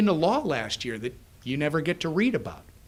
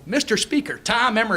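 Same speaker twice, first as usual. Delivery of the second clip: higher, eager call for attention; pestering self-introduction; 6 semitones higher than usual. Retold or imitated. Walking next to the Speaker as he passes, pestering introduction. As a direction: hail him and announce your name, raised pitch. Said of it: "I'm literally walking next to him".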